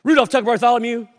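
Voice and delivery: high, loud full-name summons; frustrated parent. Retold or imitated. Parent really frustrated with the kid, calling out sharply. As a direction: yell the names like an exasperated parent. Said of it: "parental moments when you're really frustrated".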